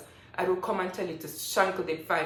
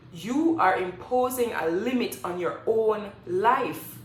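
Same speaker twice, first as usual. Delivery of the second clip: slow lecture; 3 dB louder, slower than usual. Real, not acted. Lecturing the commenter.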